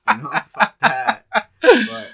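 bursting into loud laughter; laughing. Real, not acted. Laughing at own tough talk.

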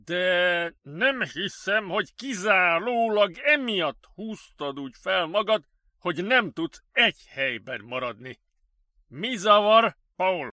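loud, swooping, drawn-out delivery; probing director. Acted. Director asking colleague what bothers him, probing. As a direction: press a colleague with emphatic words.

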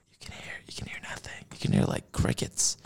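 hushed whisper, fast; breathy hush, listening for crickets. Real, not acted. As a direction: whisper so you can hear the quiet. Hushing to hear the quiet.

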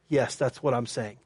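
low, soft, kindly answer; retold kindly, quiet confirmation. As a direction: answer gently, pitch dropped and voice softened. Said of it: "very kindly, he said".

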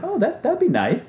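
very high, mock-innocent women's voice; innocent ladies. Innocent women accepting the guide's offer, high and naive. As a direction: imitate sweetly agreeable ladies up high.

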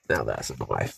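very deep, hushed mock Aussie; Australian accent. Repeating the line for laughs, deep quiet Australian accent. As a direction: drop low and soft, pretend Australian. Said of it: "talk in an Australian accent".

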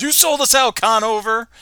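high, loud angry yell. Acted. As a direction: shout the betrayal.

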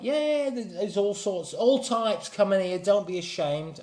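put-on high London girl voice; London girl's accent. Girl reassures a shy newcomer, high London accent. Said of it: "I'm not gonna do the accent".

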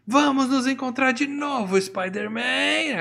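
high, drawn-out mock villain shout; villain's parting cry. Green Goblin threatens Spider-Man as he escapes, mock villain cry. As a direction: stretch it out like a cartoon villain fleeing.

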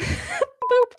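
high, playful boop; boop sound effect. Pretend. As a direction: pop out a cartoon boop. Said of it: "little boop noises".

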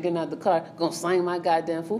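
ordinary talk

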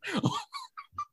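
high breathless squeak of a laugh; stifled laugh. Real, not acted. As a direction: choke back a giggle. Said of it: "I'm trying not to laugh".

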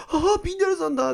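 high, quick excited squeal-like exclamation; mock starstruck fan. Fan meeting the performer at the event, mock excitement. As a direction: act thrilled at spotting your idol.

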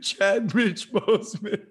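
Chad Rich Boseman, slow, laughing; mock the botched name Chad Rich Boseman; imitating the mispronunciation.

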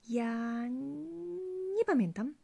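falter, then feebly deny remembering; nervous evasion. A narrator's reading. Wife cornered by her husband's questioning, nervous evasion. Wife pressed about the key.

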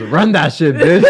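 high, loud mock demand; playful imitation of a bully; imitated movie mugger. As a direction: demand it like a comic stick-up. Retells girl robbing someone.

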